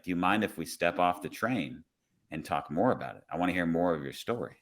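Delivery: low, soft, considerate asking; gentle request. Retold or imitated. Officer asking passenger to step off the train, gentle and low. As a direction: invite them gently, voice kept down.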